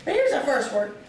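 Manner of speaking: ordinary delivery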